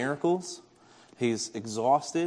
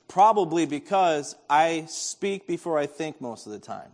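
ordinary delivery

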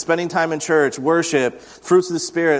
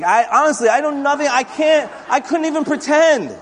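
high, emphatic confession; comic exasperation; 9 semitones higher than usual. Real, not acted. Preacher admitting to congregation he knows nothing about cars, exasperated. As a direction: protest your cluelessness in a raised pitch.

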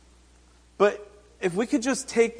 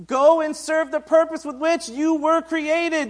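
voicing Jesus; 7 semitones higher, 4 dB louder than usual. Jesus voiced forgiving the woman.

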